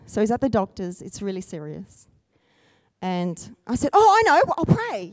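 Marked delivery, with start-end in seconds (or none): 3.9-5.1 high, bursting "oh" of remembering; retold lightbulb moment, pitched up; sudden realization. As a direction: pipe up as if it just struck you. Retells sudden idea to pray.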